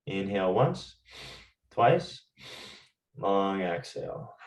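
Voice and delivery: guided breathing. Acted. Teacher guiding the class through the physiological sigh, calm breathing cues. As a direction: coach each breath gently, leaving room to breathe.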